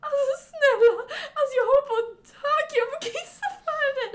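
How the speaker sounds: high-pitched, rushed begging; desperate pleading. Retold or imitated. Friend in a toilet queue begging to cut in, desperate pleading. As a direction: beg frantically to go first. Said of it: "led och verkligen så här gav blicken".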